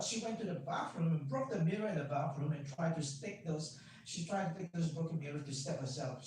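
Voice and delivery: normal talking voice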